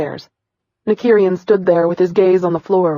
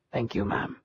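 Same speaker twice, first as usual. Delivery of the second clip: much lower and softer; faint thanks; 7 semitones lower, 14 dB quieter than usual. Acted. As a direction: barely whisper your thanks. Boy thanks the wife quietly.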